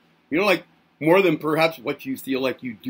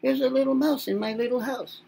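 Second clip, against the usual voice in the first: mousey voice; 8 semitones higher, 4 dB quieter than usual. Pretend. Playful singsong aside.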